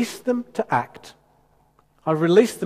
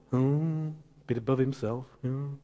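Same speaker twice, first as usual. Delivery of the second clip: softer, lower sniffy tut; mock a judging churchgoer under your breath; disapproving hmm; 5 semitones lower, 7 dB quieter than usual. Preacher imitating a disapproving churchgoer, sniffy mutter. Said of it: "we feel that we can't say anything good".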